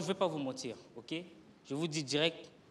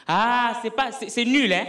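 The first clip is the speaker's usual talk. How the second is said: heckling crowd; 5 semitones higher, 6 dB louder, slower than usual. Retold or imitated. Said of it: "Tout le monde de la salle commençait à dire".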